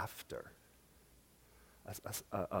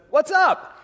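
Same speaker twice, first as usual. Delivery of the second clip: much higher easy what's up; breezy higher answer; high casual reply. Acted. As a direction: toss it back lightly.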